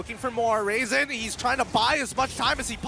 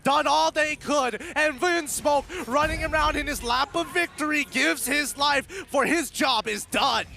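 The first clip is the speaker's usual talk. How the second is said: hyped play-by-play, higher and louder; esports caster; 8 semitones higher, 4 dB louder than usual. Acted. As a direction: build the excitement as the teamfight breaks.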